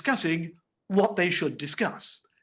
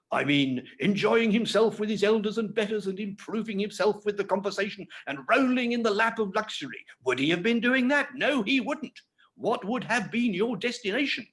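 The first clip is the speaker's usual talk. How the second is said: high, self-important uncle, slowing on squeaker; a pompous uncle lecturing the boy; pompous Pumblechook; 8 semitones higher than usual. A narrator's reading. Reader voicing Pumblechook.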